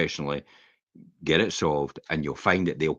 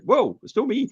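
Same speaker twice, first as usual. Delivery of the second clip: much higher protesting whoa; defensive surprise; 3 dB louder than usual. Retold or imitated. Husband protesting innocence to his wife, high and startled. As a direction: throw up your hands, it's not me. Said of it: "it's like".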